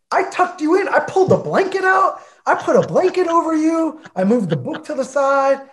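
high, hammed-up self-praise; mock-doting indignation. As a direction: play up the caring roommate, voice way up. Boasting of tender roommate care.

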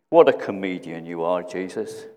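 low scoffing with a short chuckle; mocking laughter. Preacher acting the mourners who laughed at Jesus, understated low mockery. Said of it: "they laughed".

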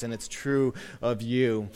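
ordinary talk, nothing marked

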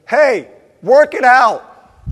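loud, high, drawn-out parental yell with a laugh; yelling upstairs. Retold or imitated. Dad yelling up the stairs at fighting girls, loud. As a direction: call it up the stairs, half laughing. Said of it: "I'll go to the steps and I'll go".

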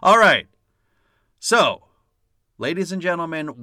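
loud showman kickoff; rally the audience into the main topic; announcer-style all right; host announcing. Acted.

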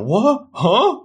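high, questioning yelp of confusion; bewildered. Acted. Dressmaker baffled by her sudden demand, bewildered. Acted bewildered reply to odd request.

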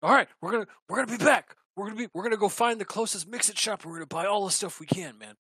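fast, high and loud; eager rush. Acted. Orcs leaving for the shop, excited fast. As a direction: hurry the words out.

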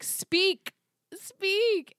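loud, high-pitched urging; exasperated high shout, one word at a time; mock demand. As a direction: yell it impatiently at a speechless character. Mock-urging a silent character.

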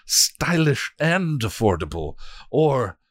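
high, slow sycophantic voice; fawning minion. Acted. Another minion adding praise to the god, fawning. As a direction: flatter with eager servility.

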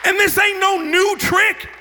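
high, driving sermon shout; fired-up preacher in full flight; full-force preaching. Real, not acted. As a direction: build to a ringing pulpit cry.

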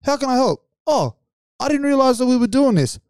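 a friend feigning he never knew; mock innocent. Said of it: "And he goes".

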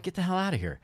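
high, incredulous exclamation in a retelling; retold disbelief. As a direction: act out your amazed reaction, pitch shooting up. Retold disbelief at the t-shirt reveal.

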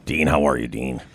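low gravelly Castiel impression; deep rough Misha Collins voice. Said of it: "Mishy Collins, like, struggled on Supernatural?".

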